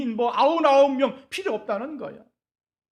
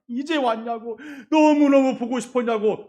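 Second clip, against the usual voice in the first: tearful joyful welcome; 4 semitones higher, 6 dB louder than usual. Shown as a demonstration. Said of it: "눈물도 좀 흘려봐".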